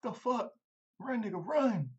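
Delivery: high, loud frantic mock shout; hammed-up panic; panicked yell. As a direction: scream at him to flee. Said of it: "He's like".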